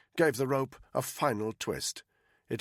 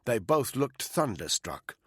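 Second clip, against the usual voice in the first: ordinary delivery, nothing marked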